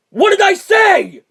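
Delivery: high, loud and drawn-out; exasperated shout. Acted. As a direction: blow up at them in disbelief. Panicked outburst at friends.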